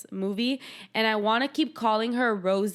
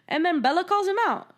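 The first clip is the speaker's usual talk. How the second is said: normal talking voice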